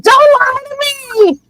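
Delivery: very high, whiny outburst; mock wail. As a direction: wail it like an offended drama queen. Myrtle wails at the flatterers.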